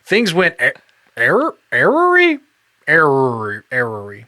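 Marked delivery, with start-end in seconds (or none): 1.2-4.3 "errory" said like error plus ee, in place of awry; mispronouncing awry. Retold or imitated. Imitating a friend misreading awry. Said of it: "struggling reading the word awry".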